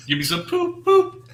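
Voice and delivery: squeaky high cartoon voice, pitch way up; joking falsetto riffing on a gag; silly high voice. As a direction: clown it up in a goofy falsetto. Joking about poop blurb.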